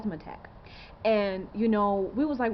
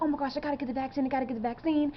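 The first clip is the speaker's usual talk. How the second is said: higher, fast panicky voice, imitating; mock freak-out; 4 semitones higher, faster than usual. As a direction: play a panicked crowd, rushing the words. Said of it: "people are gonna be freaking out".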